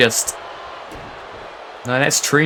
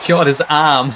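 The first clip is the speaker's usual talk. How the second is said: usual speaking voice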